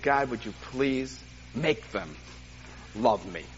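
slow, low plea with a pause before the end; retold heartfelt plea; begging prayer. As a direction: beg God slowly and quietly. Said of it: "I begged God for love".

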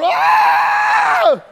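very high, loud held scream; newborn scream. Retold or imitated. Newborn seeing the world, long high scream. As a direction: wail like a newborn.

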